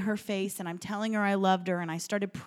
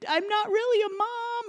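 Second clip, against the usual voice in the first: very high, squeaky plea on the verge of tears; imitated tearful meltdown, pitched way up; tearful puddle; 12 semitones higher than usual. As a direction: act out falling apart in a high wail. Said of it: "just became like a puddle".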